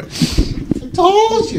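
very high, very slow mock voice; mock New Jersey voice. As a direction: stretch it out in a squeaky Jersey voice. Mock accent voice.

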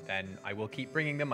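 ordinary delivery, nothing marked

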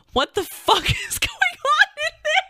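high squealing voice breaking into laughter; laughing, wailing mock disbelief; incredulous mimicry. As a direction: imitate a baffled visitor while cracking up. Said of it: "standing there for a bit being like".

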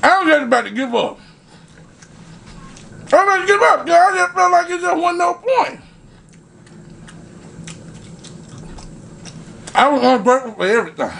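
chewing while talking; speaking between bites; mouth full. Real, not acted. Seen 2: Bites a sausage patty at 3.8 s; chewing and talking throughout.

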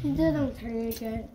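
slurping noodles while talking; talking with noodles hanging out; mouth full of noodles. Real, not acted. Seen 0: Noodle strands hang from the child's lips while speaking.

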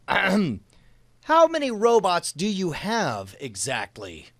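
slow, drawn-out question; slow dictation. Shown as a demonstration. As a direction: spell out each word for the app. Dictating a quoted question slowly.

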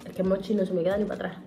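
sticky food in mouth; talking while chewing. Real, not acted. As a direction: mouth partly full. Seen 0: Bites mochi pieces at 4.0–5.3s; mouth working while talking throughout.

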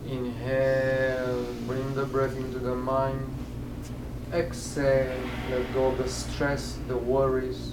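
slow, measured meditation cues with long-drawn words; guided breathing meditation. Acted. Teacher guiding breath into the mind, slow meditation voice. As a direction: lead a slow breath in and out, leaving pauses.

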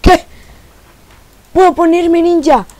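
loud, high-pitched disbelief; high, loud incredulous question; astonished shout. Real, not acted. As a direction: cry out in real amazement. Startled that the name is free.

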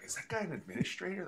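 a lightly voiced puzzled young player; mock confused. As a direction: ask it fast like a baffled newcomer. Imitating confused rookies at meetings.

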